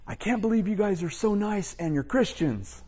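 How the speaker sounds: high-pitched comic imitation of an incredulous relative; relative's retold outburst. Angry relative at the door to the visiting couple, high put-on incredulous outburst. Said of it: "this is what he said".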